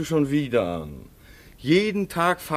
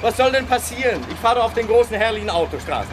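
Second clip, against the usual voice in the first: much higher, fast, breezy; cheerful reassurance; 10 semitones higher, faster than usual. Acted. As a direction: brush off her fears brightly. Son cheerfully reassures mother. (fröhlich beschwichtigend)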